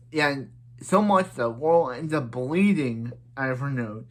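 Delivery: normal talking voice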